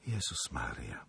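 faint, voiceless whisper; shocked repetition. A narrator's reading. Helena echoes Zagloba before bursting into tears, stricken whisper. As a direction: breathe it out in a low whisper, near tears. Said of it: "powtórzyła Helena i wybuchnęła płaczem".

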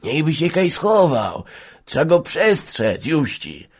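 put-on high, slow old man's voice; stammering old man. A narrator's reading. Old peasant urging that Rocho be warned, stammering high. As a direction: plead slowly in a high voice. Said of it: "jąkał Bylica".

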